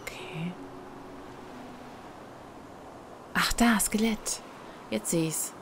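soft unvoiced whisper. Real, not acted. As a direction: whisper as if sneaking. Creeping through dark place.

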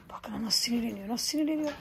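softly whispered to the viewers; whispered aside. Real, not acted. Telling viewers the animal is angry, in a whisper. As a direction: share a secret with the camera.

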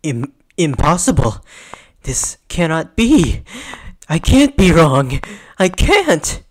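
panicked denial. Acted. Character refusing to be wrong, mounting panicked denial. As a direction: build from stunned to loud, high denial.

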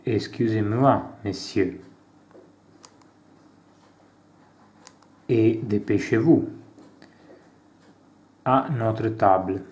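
reading out a café dialogue with light character voices; acted dialogue reading. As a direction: play both sides of the café scene, lightly. Reads French café dialogue aloud. (lettura animata di un dialogo in francese)

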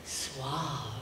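low, smooth ad voice; commercial announcer voice. Shown as a demonstration. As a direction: purr it like a shampoo ad. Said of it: "the one that I used to practice all the time".

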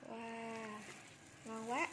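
talking through a hot mouthful; eating hot soup while speaking; mouth full of hot food. Real, not acted. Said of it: "nóng".

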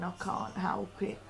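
ordinary delivery

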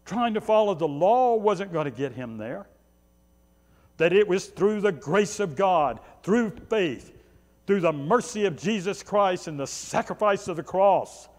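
ordinary talk

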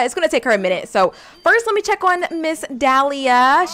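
ordinary talk, nothing marked

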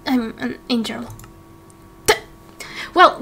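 ordinary talk, nothing marked

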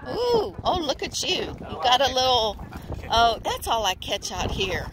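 high-pitched friendly small talk; high bright greeting voice; friendly chatter. Real, not acted. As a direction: chat up the fishermen cheerfully. Greeting strangers on the pier.

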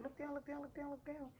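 high, rapid-fire urging; imitated excited urging. Staff as baby starts coming out, excited urging.

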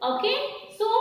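usual speaking voice

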